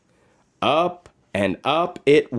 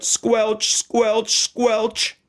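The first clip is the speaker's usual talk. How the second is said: higher, squishy squelches; squelch sound words; 10 semitones higher, much slower than usual. A narrator's reading. Narrator sounding boots in a bog, higher squelches. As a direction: make the mud squelch. Bear in the mud.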